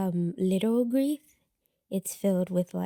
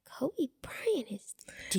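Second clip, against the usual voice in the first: stunned whisper; 5 semitones higher, 7 dB quieter, slower than usual. Retold or imitated. Retold shocked reaction.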